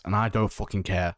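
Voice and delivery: ordinary delivery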